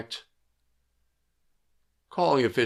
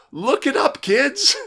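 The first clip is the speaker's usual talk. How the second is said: high, louder teasing call-out; playful high jab; 13 semitones higher, 6 dB louder than usual. Real, not acted. Instructor teasing young officials in a high, louder playful voice. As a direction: tease the youngsters. Joking callback to the kids.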